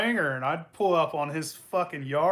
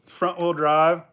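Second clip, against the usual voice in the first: ordinary delivery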